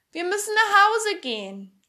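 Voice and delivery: loud, high-pitched call; Pipi. A narrator's reading. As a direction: shout it to a faraway companion. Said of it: "schrie sie in der nächsten Minute mit lauter Stimme".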